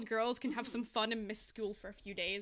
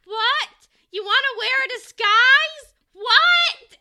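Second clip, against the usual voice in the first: high, loud, slow shrieking disbelief; high squeal; 11 semitones higher, 16 dB louder, much slower than usual. Retold or imitated. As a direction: shriek it like a baffled doll. Acting out Barbie scene.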